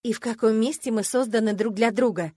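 loud, cross challenge; angry. A narrator's reading. Heroine disputing her roommate's claim, angrily. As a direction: demand an answer crossly. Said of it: "сердито спросила я".

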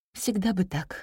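quiet, wistful thought; a mild touch of longing, softly. A narrator's reading. Teacher longing silently for such quiet in class, wistful. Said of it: "с тоской подумала учительница".

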